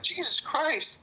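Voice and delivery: high, slow and stunned; a slow, high exclamation of disbelief. Real, not acted. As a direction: let the shock of it stretch out. Recalling the scare while driving.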